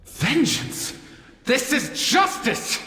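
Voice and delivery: high, heated protest; indignant outburst. Acted. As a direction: fire back in righteous anger. Angry young Dracthyr argues.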